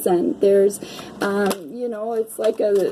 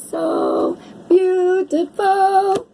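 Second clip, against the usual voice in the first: much higher and very slow, stretched out; drawn-out adoring coo; 10 semitones higher, much slower than usual. Real, not acted. Reader marveling at a card, high slow adoring coo. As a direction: savor every word in delight.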